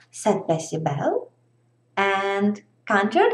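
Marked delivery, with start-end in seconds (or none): none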